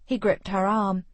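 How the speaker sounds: ordinary delivery, nothing marked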